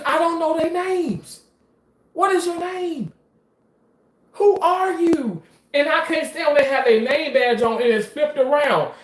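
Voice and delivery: high, strained exasperation; exasperated rant. Real, not acted. Ranting about coworkers' unknown names, exasperated. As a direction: pitch it way up in disbelief.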